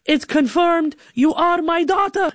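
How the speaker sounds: high, beaming proud declaration; dad praising his winning daughter, high and proud. Retold or imitated. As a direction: announce it with pride. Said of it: "He's like".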